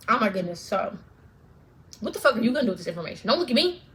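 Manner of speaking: ordinary talk, nothing marked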